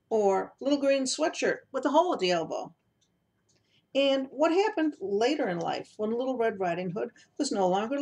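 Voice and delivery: lively, wry read of her own book; reading aloud. Acted. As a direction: read the intro as if amused by it. Author reads her book aloud.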